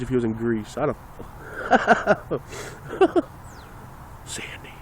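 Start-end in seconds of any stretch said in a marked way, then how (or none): 1.2-3.6 high, wheezy burst of laughter; laughing. Real, not acted. Amused by imagined casting. 4.2-4.7 hushed, whispered movie-line impression. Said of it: "I'm gonna whisper the whole movie".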